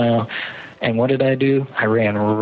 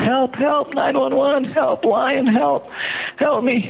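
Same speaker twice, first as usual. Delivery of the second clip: high desperate cry for help, much higher; screaming for help; slower than usual. Retold or imitated. Runner screaming to a couple for help. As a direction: yell for help in panic. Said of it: "I just started screaming".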